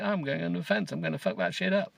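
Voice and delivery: high-pitched cheeky dog; comic high voice for a naughty dog; mock dog voice. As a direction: play a dog bragging about mischief. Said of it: "They're just like".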